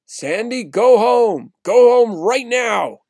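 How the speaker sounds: high, loud, slow shout of an order; loud commanding shout. Retold or imitated. Neighbor Glenn orders Sandy home, in a loud high shout. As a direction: bellow it with full authority. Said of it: "shouted in a loud voice".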